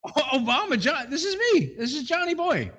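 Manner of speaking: high, lightly mimicked Obama tone; a hint of Obama's cadence; Obama impression. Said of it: "an Obama kind of way saying it".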